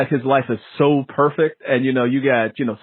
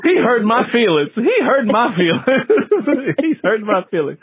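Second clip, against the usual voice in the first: mock hurt; 8 semitones higher than usual. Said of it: "joking mock hurt".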